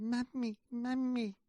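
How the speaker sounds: put-on high child voice calling out; put-on high, pestering child; little boy. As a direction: wake mum like an eager small boy. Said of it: "a little boy rushes into".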